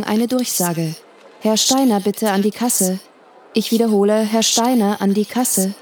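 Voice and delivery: ordinary delivery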